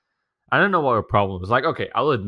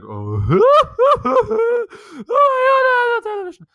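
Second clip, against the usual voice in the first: much higher, stretched-out hammed-up despair; mock dramatic wail; 8 dB louder than usual. His sister making a big scene over the TV switching off, hammed-up wailing. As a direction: wail it like a melodramatic child. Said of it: "she made a big scene out of it".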